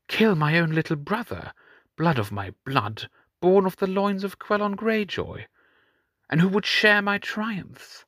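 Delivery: high, mocking sneer; mocking king. Euron taunts his chained brother, high mocking voice.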